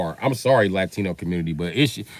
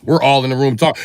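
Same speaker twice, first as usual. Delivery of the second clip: ordinary delivery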